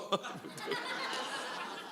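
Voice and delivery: high breathy burst of laughter; real laughter; amused laugh. Real, not acted. Laughs at the pastor's joke.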